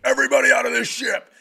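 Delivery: high, loud, rapid-fire command; imitated barked order. Intruder bursts in from the dark side, shouted order. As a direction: burst in yelling orders, acted out.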